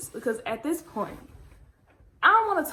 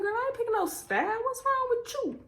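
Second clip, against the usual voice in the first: high, fast sassy retort; sassy refusal; 12 semitones higher than usual. Retold or imitated. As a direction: brush her off with attitude. Refusing mom, retold.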